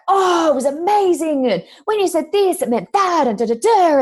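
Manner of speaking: high-pitched, gushing imitation; completely animated. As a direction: gush it like an excited client. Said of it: "completely animated".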